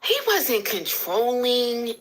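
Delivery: slow, stretched mock repeat; drawn-out echo. Repeating the viewer's question to her live audience, slow and drawn out. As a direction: drag out each word as if mulling it over.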